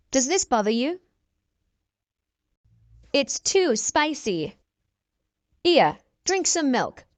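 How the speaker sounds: normal talking voice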